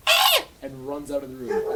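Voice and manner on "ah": scream. Retold or imitated. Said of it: "And the guy goes".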